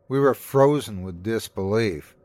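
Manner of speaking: ordinary delivery, nothing marked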